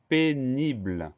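"pénible" slow and drawn out in separate syllables. Shown as a demonstration. Teacher presenting the next-to-last word, "pénible" syllable by syllable. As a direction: sound out "pénible" slowly, stretching each syllable. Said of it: "Première syllabe P".

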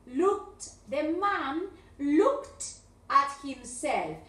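"looked" with a short final t, said slowly; model looked. Shown as a demonstration. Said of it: "pronunciar mal los verbos en pasado".

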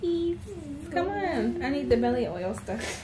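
tired begging. Real, not acted. Tired mom softly begging her daughter for the belly oil. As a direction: beg softly, worn out.

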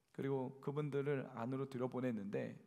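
ordinary talk, nothing marked

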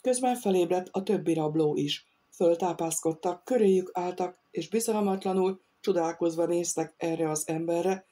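ordinary delivery

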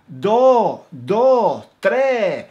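loud "do, do, tres" with the final S dropped; Andalusian counting, loud. Shown as a demonstration. Teacher showing the Granada "do" and "tre", loud and clear. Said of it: "yo decía do".

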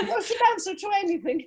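high-pitched joke through laughter; words riding on a high laugh. Real, not acted. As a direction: laugh as you tease. Joking about her many names.

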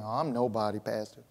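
low, soft, mopey put-down of self; mock mopey humility. Pastor mimics a churchgoer calling himself nobody, soft and mopey. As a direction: mumble it like someone who thinks they're nobody. Mocking a falsely humble churchgoer.

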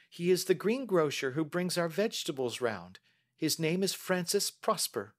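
put-on high-pitched young lady, earnest; Mary. Young lady telling the detective what she heard, earnest. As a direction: answer carefully in a high voice.

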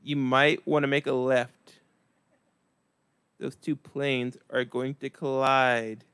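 slow, flat calm over a disaster; deadpan monotone. Shown as a demonstration. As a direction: announce the crash like it's nothing. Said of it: "because I'm so monotone".

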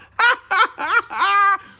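high, loud cackle; uproarious gloating laugh. A narrator's reading. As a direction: let out a long theatrical ha-ha-ha. Mister Dart laughs at his joke.

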